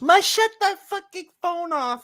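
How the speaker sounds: shrill-pitched mock scold about the phone; mocking mom scolding. Son imitates mom yelling about his phone, very high. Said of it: "she goes".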